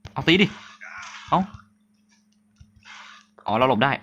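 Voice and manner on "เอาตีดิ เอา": excited urging. Real, not acted. Pig attacks in game.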